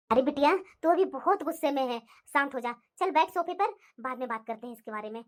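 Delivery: low, calm mother voice; mother calming her angry daughter, low and gentle; soothing mother. Acted.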